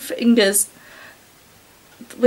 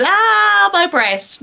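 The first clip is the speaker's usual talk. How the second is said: mock moan; 8 semitones higher, much slower than usual. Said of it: "the first thing you're gonna say is".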